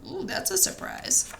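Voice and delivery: ordinary delivery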